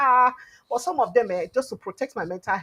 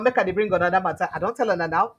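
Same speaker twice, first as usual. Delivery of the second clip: usual speaking voice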